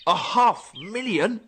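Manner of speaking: can't believe how far back; shocked disbelief. Acted. Shocked at the date.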